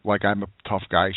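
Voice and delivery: ordinary talk, nothing marked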